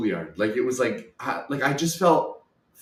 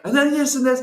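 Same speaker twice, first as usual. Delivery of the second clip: high-pitched, worked-up mock complaint; escalating monologue; 11 semitones higher, 4 dB louder than usual. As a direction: let the retold rant climb high. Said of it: "slowly getting through the monologue".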